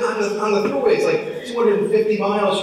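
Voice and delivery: high-pitched comic objection; retold protest. Husband objects to wife's long cycling plan, in high-pitched protest. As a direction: argue it in mock alarm.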